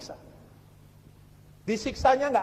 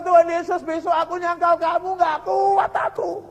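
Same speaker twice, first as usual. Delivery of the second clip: imitated tearful wail; 7 semitones higher, 4 dB louder than usual. Prisoner confessing to Jesus he will deny him, acted wailing. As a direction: plead with God as if at breaking point, acted. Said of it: "Nangis dia, saudara".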